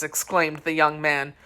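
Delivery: plain reading voice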